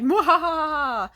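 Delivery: slow, high, loud evil cackle; hammed-up evil villain laugh. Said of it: "have a fucking evil villain change".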